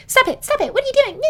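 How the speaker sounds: high frantic protest; panicked. Retold or imitated. Polly panics, pushing Oren away.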